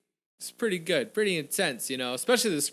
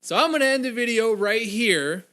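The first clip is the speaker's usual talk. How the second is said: louder, higher outro push; cheery louder channel sign-off; loud upbeat outro; 7 semitones higher, 6 dB louder than usual. Real, not acted. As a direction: sign off to the viewers with energy. Outro to viewers.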